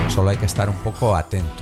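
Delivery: normal talking voice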